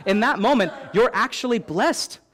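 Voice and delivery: preacher building conviction; preaching. Acted. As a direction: drive the point home to the church.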